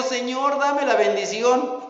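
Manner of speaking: high, loud begging cry to the Lord; tearful plea. Retold or imitated. Esau begs God for the blessing, tearful. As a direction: beg with mock tears. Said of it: "con lágrimas dijo".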